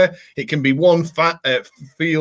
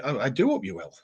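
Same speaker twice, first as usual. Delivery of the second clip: softer, mock-polite repeat; mock-posh repeat; 2 semitones higher, 7 dB quieter, faster than usual. Shown as a demonstration. Echoing a viewer's sign-off in mild mock politeness. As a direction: gently ham up the politeness. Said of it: "the Mickey taking is continuing".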